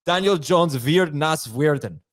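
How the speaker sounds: a joking slow German line; German accent, deliberately hammed-up; mock German accent. Co-host teasingly translating for Dave, in German accent. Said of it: "you're at least using a German accent".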